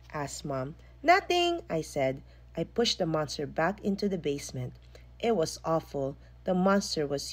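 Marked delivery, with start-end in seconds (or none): 1.0-1.6 quick high denial. A narrator's reading. Child hiding the monster.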